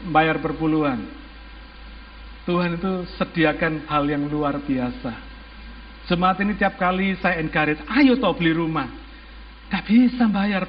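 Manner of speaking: usual speaking voice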